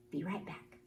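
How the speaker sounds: ordinary delivery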